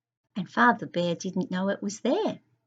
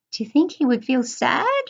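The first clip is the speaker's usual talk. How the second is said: ordinary delivery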